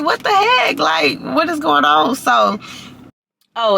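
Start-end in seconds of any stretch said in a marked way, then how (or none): 0.0-2.2 high-pitched retold bafflement, easing down; exasperated disbelief. Caller getting no answer, retold exasperated disbelief. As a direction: start high in disbelief, then draw out the question. Retelling frustration at unanswered calls.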